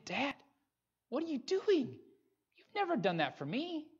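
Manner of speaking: high, protesting question; incredulous and hurt; older son protesting to his dad; indignant son. Retold or imitated. Brother protesting to father.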